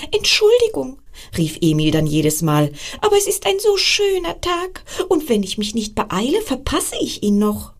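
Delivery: high, bright squirrel voice; excited squirrel. Acted. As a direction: chirp it out like an eager little squirrel. Squirrel Emil answers excitedly.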